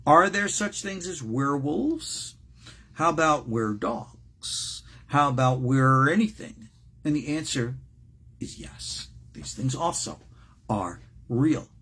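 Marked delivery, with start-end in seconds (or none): none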